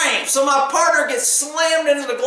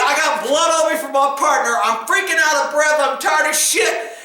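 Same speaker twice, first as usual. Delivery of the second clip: ordinary delivery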